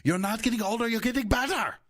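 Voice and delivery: high, strained mock-German shouting; angry German accent. Sketch German paying a compliment, in an angry German accent. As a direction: yell a kindness like a furious German. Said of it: "said in a really angry German accent".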